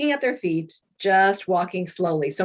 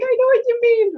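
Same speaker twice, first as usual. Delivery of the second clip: much higher, laughing squeal; laughing along; 5 dB louder than usual. Retold or imitated. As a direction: giggle it out, agreeing. Laughing agreement with husband.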